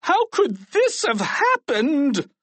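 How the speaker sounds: slow, high, exasperated cry; principal's drawn-out outburst. A narrator's reading. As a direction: wail it in disbelief, word by word. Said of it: "cried Mister Krupp".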